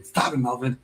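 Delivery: usual speaking voice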